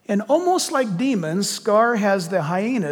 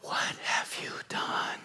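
unvoiced hissing whisper of the tempter; seductive whisper; 3 semitones lower, 6 dB quieter, slower than usual. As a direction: breathe it like a sly devil in the ear. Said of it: "in that seductive voice".

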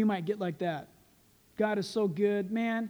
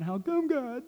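higher, plaintive mock whine; mock crying complaint; 5 semitones higher, slower than usual. Preacher mimics himself crying in a corner, mock whiny. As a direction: lightly act a self-pitying plea. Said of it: "I'm not crying in some corner".

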